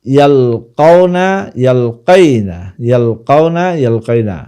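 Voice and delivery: ইয়ালকাউনা and ইয়ালকাইনা drawn out with stressed middle syllables; pronunciation demonstration. Shown as a demonstration. Teacher showing students the verb's pronunciation, ইয়ালকাউনা, ইয়ালকাইনা slowly modelled. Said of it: "উচ্চারণটা সামি আসমাউ থেকে কিভাবে হবে".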